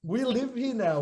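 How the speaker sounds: high, exasperated retold parent; hammed-up defensive father; pleading dad. Dad's retold reply to upset son.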